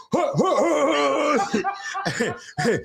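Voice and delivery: slow, high, breathy huh grunts; mock panting. Teller imitating Greg on the porch, slow panting huhs. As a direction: puff out huh, huh slowly like Greg. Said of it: "he used to go on the porch and go".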